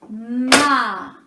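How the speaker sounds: loud "Homard" split into two held beats; syllable by syllable. Shown as a demonstration. Teacher inviting learner to join, syllable by syllable. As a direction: stretch and separate both syllables of "Homard". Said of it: "Fais-le avec moi".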